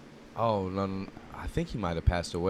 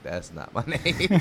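laughing; 6 semitones higher, 5 dB louder, slower than usual. Retold or imitated. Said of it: "I'm like".

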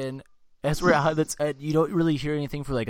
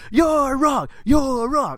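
high, loud repeated shout; mock yelling; 14 semitones higher, 4 dB louder, much slower than usual. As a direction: imitate a friend hollering a taunt. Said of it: "just starts yelling it like that".

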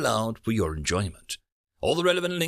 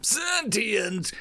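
mocking scoff; 10 semitones higher, slower than usual. A narrator's reading. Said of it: "the barking laugh".

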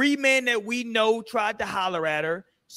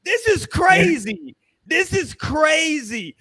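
loud, slow, raised-pitch outcry; exclaimed disbelief; 5 semitones higher, 3 dB louder, slower than usual. Real, not acted. Host reacting to her video, exclaimed disbelief.

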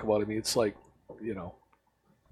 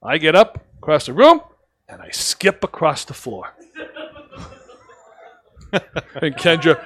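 ordinary talk, nothing marked

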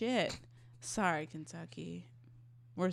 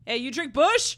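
higher, loud mock field-research shout; hollered question; 7 semitones higher, 11 dB louder than usual. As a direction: call out loudly to a stranger. Acts out quizzing rednecks on beer.

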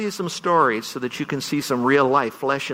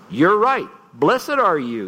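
Jesus affirming; 5 semitones higher, 3 dB louder, slower than usual. Retold or imitated. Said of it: "Jesus said to him".

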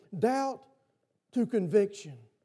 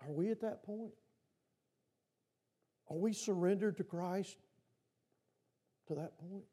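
much softer, searching question; quiet probing; 2 semitones lower, 10 dB quieter than usual. Real, not acted. Preacher softly challenging the congregation's surrender, quiet. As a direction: ask it gently, almost under the breath.

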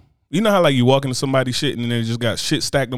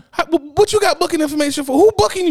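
high, incredulous mockery; mock them with disbelief; 13 semitones higher than usual. Real, not acted. Mocking booking information.

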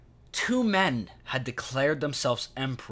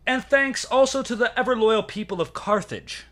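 roman orator; 8 semitones higher, 4 dB louder than usual. Acted. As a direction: declaim it to the Senate like a Roman orator. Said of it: "And I quote".

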